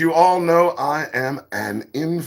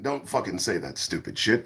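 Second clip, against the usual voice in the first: normal talking voice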